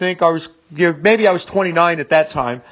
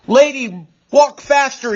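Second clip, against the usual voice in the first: raised, higher-pitched mock yell; retold yelling; 6 semitones higher, slower than usual. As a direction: imitate an officer yelling orders. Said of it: "he started yelling and screaming at".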